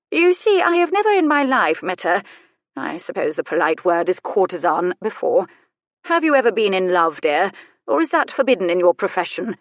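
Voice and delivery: acted old aristocrat probing with arch delicacy; inquisitive old lady. Old lady quizzes the courtesan.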